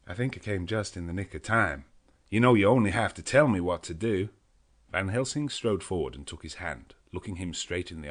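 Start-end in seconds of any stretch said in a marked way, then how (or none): 0.1-4.3 lightly voiced American cowboy; Quincey Morris. A narrator's reading. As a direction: offer help plainly.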